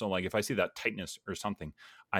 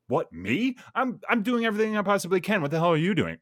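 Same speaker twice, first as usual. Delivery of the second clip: much higher and louder, mock indignant; defensive outburst; 11 semitones higher, 6 dB louder than usual. Imagined reply to a critical coworker, hammed-up defensive anger. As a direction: fire back like you're insulted. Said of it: "easy to become defensive in that moment".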